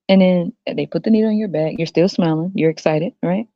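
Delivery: usual speaking voice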